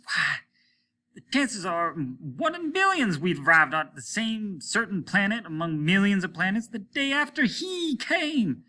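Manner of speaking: high, rising urgent plea; feverish. A narrator's reading. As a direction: race into obsession. Captain's rising obsessive plea.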